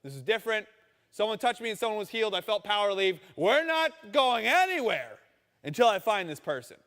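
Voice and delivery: Jesus digging in his heels; insistent Jesus. Retold or imitated. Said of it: "He's like".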